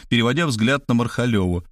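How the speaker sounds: usual speaking voice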